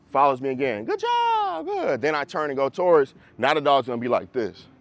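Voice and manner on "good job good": high praise voice. Retold or imitated. Trainer praising the dog as it follows, high and sing-song. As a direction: cheer the dog on in a much higher voice.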